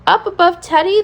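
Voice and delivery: usual speaking voice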